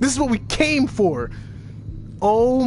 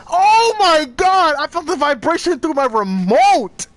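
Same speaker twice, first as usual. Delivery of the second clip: loud, high-pitched horrified outburst; real shock; 7 semitones higher, 6 dB louder than usual. Real, not acted. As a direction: cry out in stunned disbelief. Shocked by violent game scene.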